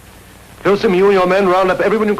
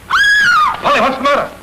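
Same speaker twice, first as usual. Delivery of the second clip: alarmed shout; slower than usual. Real, not acted. Finding Polly hurt, he shouts in alarm. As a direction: shout her name in alarm.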